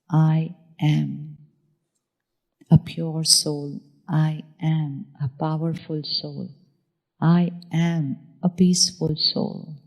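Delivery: soft, slow, inward affirmations; slow guided affirmation. Shown as a demonstration. Teacher guiding the audience through self-blessings, slow and soft. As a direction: say each blessing calmly, like a mantra. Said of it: "Give the blessing for yourself".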